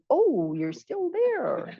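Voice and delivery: drawn-out "oh", a gentle mock discovery; mock surprise. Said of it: "she popped up and I said".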